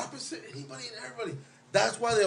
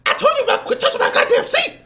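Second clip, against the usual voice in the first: high, loud bellowing big woman voice; Norbert impression; 10 dB louder than usual. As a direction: yell it as a bossy, furious wife. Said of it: "let me do my Norbert".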